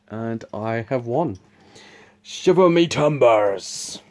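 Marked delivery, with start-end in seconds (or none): 2.2-4.0 slow, drawn-out pirate voice; pirate impression. Shown as a demonstration. Game winner celebrates with a put-on pirate impression. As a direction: draw it out like a hammed-up pirate. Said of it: "That was my pirate impression".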